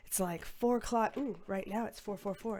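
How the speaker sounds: ordinary talk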